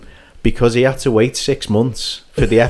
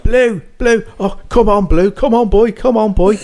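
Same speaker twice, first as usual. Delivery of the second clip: calling a dog; 10 semitones higher than usual. Retold or imitated. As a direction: shout after the runaway dog. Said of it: "Followed by a shout from behind".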